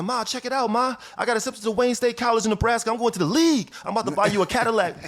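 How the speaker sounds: high, thrilled announcement to mom; proud son shouting good news to his mother; excited. Retold or imitated. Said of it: "I said".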